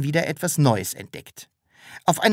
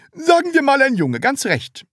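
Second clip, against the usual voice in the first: high, fixed-grin salesman voice; stiff smile; 13 semitones higher, 5 dB louder than usual. A narrator's reading. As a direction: say it through a frozen smile. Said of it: "mit starrem Lächeln".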